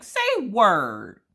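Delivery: high, exaggerated disbelief, last words stretched out; incredulous. Reacting to the post office clerk's huge price drop, playful disbelief. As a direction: build from amazed to mock accusing.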